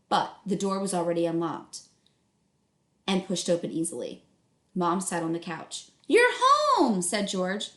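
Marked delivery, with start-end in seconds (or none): 6.1-7.0 high, loud startled mom voice; sudden high-pitched mother's line; Mom's sharp greeting. Acted. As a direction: call it out high like a tense mother.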